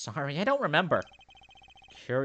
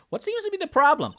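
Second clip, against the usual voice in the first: character voice; 9 dB louder than usual. Acted. Character voiced high and loud.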